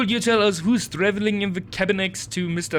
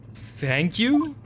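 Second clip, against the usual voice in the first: normal talking voice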